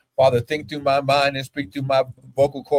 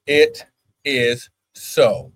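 slow emphatic; 4 semitones lower, 4 dB louder, much slower than usual. Shown as a demonstration. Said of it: "I'm gonna say it again".

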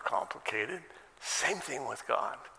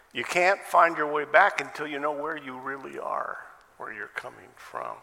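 ordinary talk, nothing marked

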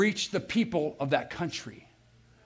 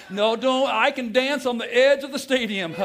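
hammed-up taunting, laughing, higher and louder; gloating stayer; 6 semitones higher, 4 dB louder than usual. Preacher mocking the man spared from mission field, gloating laugh. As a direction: tease them from the stadium edge, laughing. Mock bravado continued.

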